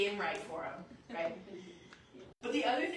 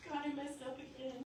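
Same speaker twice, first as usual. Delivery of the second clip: higher, softer, meek admission; trembling confession; 5 semitones higher, 5 dB quieter than usual. Acted. Believer to God at the foot of the cross, meek trembling confession. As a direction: own up timidly, small and high. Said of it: "we finally come shaking and trembling".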